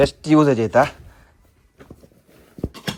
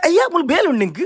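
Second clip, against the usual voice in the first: very high voice, falling at the end; high-pitched exclamation. Real, not acted. Vlogger to a companion while packing, high-pitched exclamation. Talking to Bablu during packing.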